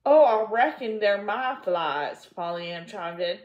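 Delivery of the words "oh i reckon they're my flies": put-on loud, bright child's voice; eager confession; little girl. A narrator's reading. Pollyanna owning up to her aunt, bright and loud.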